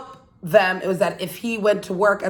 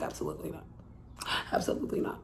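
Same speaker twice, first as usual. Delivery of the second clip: whispered flat refusal, much softer; cold refusal; 4 semitones lower, 16 dB quieter, much faster than usual. Retold or imitated. Wife refusing her begging husband, a soft whispered no. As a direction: hiss a quiet final no. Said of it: "She's like".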